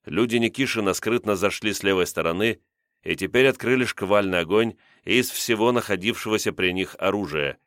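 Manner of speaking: plain reading voice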